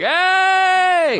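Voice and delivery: high, loud greeting shout; excited shout. Retold or imitated. Greeting a stranger at a party with a big shout. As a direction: yell hello like a long-lost friend. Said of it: "I was like".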